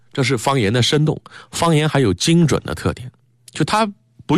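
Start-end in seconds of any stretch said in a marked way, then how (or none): none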